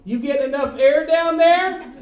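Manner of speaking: over-helpful loud question; loud patronizing call. Acted. Comic asking a man with an oxygen tank, loudly. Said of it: "I'm gonna be like".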